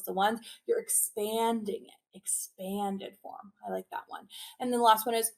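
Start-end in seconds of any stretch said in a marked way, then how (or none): 2.2-3.5 Expanded form drawn out very slowly and softly, like opening hands; stretched-out demo. Shown as a demonstration. Teacher showing the class how to stretch it out slowly. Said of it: "Make your hands like a jellyfish".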